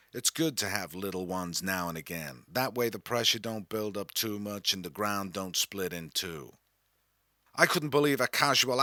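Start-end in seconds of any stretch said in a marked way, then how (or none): 0.1-6.5 low, soft, lazy American drawl; transatlantic drawl. Retold or imitated. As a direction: wave away the worry in a lazy American drawl. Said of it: "in her transatlantic drawl".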